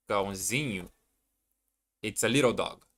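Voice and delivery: usual speaking voice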